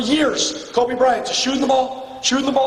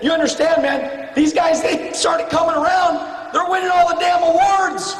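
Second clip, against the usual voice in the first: retold fed-up shout; 7 semitones higher than usual. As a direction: yell it like they're stealing your trophies. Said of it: "I said, I said".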